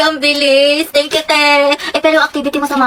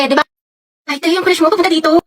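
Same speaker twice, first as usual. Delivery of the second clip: normal talking voice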